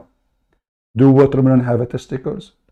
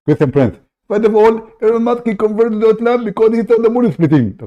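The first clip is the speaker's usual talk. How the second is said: raised, pushy put-on caller voice; fake caller voice; 9 semitones higher, 4 dB louder than usual. Prank caller cutting into the host's show, high put-on voice. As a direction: barge in as an eager fake caller.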